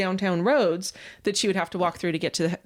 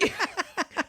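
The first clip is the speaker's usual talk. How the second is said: giggle; 8 semitones higher, 5 dB quieter than usual. Real, not acted.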